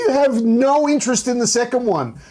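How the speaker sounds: can't believe what he's hearing; exasperated disbelief. Real, not acted. Co-host challenges Pat's lack of interest, exasperated.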